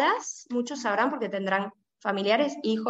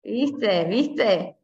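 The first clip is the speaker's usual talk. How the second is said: put-on Argentine accent; 2 semitones lower than usual. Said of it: "Te estás argentinizando bastante".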